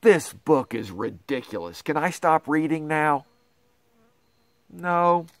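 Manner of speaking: ordinary delivery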